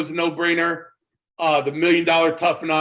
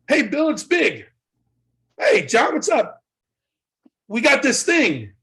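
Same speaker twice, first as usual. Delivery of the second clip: lightly voiced Big, higher; 7 semitones higher than usual. Voicing Big on phone.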